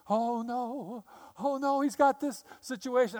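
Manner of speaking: high, mock-panicked worrying voice; panicking God. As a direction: play a frantic, fretting God. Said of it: "I just don't think that's the way God works".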